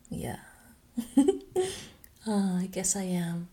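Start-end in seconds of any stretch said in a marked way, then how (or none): 1.0-1.8 quick giggle that jumps higher. Acted. Admitting to her partner she is dirty too, high giggle. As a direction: laugh at herself, a little embarrassed. Laughs at being called dirty.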